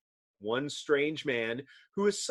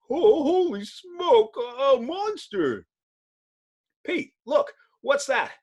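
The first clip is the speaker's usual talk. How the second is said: scared onlooker; 12 semitones higher than usual. Acted. Bystanders spotting the Thing, high and alarmed. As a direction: cry out at a monster.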